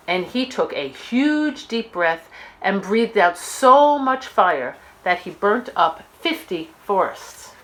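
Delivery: plain reading voice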